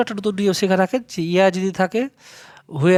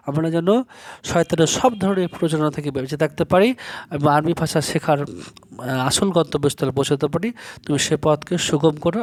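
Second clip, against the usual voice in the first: prayer; 4 semitones lower than usual. Acted. Teacher closes class with prayer.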